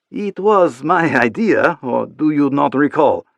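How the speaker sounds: playful French lover teasing; light French accent. Acted. Isabella teases Chapman in French accent.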